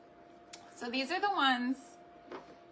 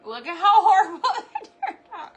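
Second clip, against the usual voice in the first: laughing hard, much higher and louder; 10 semitones higher, 17 dB louder than usual. Real, not acted. Laughing at her own messed-up dough to viewers. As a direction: crack up at the mess.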